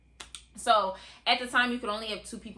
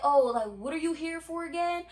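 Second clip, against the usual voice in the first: higher, airy mock guard asking what they're here for; clueless guard; 8 semitones higher than usual. Guard casually asks why they came, lightly mocked. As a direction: play the guard dim and unhurried. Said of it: "the security was like".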